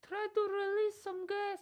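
put-on very high squeaky German accent; silly high German voice. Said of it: "give me a voice".

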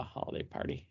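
ordinary delivery

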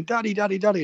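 a hint of a small son's high-pitched call; excited little boy. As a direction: imitate an eager kid calling Dad. Small son runs out to greet him.

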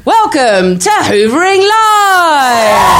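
big, slow, high showbiz welcome; a high, drawn-out shout to open the show; calling out to the crowd. Real, not acted. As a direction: throw the welcome out to the whole room like a compere. Host opening the live show.

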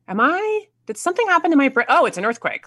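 high, rapid mock confusion, easing into realization; retold bewilderment. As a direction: act out a startled inner voice, settling at the end. Acts out confusion as quake starts.

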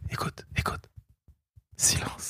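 hushed whisper, no voice at all. Retold or imitated. Father shushing his kids at home, whispering. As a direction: whisper to make the kids listen.

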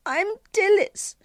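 very high little-girl voice, acted tearfulness; narrator's high-pitched tearful girl; sobbing child. A narrator's reading. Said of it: "she sobbed".

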